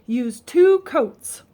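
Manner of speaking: slow, loud, stressed "two"; very slow, emphatic note reading; quoting dad's note. A narrator's reading. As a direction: spell out the instruction. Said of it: "Dad wrote".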